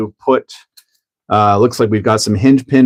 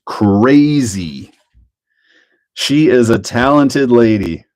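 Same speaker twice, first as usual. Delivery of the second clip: usual speaking voice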